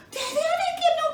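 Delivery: put-on high, teary girl protesting; a daughter near tears, voice way up high; crying girl. Daughter denies giving away money, high and teary.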